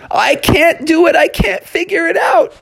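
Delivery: frantic high cry of failure; panicky cry. Acted. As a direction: wail in rising despair. Techno Bear panicking, parents arriving.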